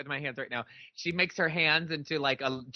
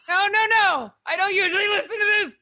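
high, fast, louder embarrassed denial; flustered high protest; 7 dB louder than usual. Retold or imitated. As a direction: squeal out an embarrassed excuse.

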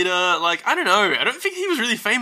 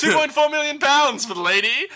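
higher-pitched, quick auctioneer's call; 7 semitones higher than usual. Acted. Auctioneer announcing a lady's bid to the room, mock auctioneer voice. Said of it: "The auctioneer takes it as a bid and is like".

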